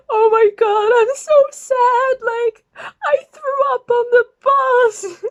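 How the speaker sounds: high, loud wailing sob; mock sobbing. Drunk girl sobbing to strangers, high loud wail. Retelling crying at the party.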